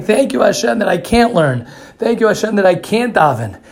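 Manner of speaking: high, exultant acted praise; imitated jubilation, pitch jumping up; retold joyful thanks. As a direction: give thanks as if dancing with joy. Retold dancing and thanking God.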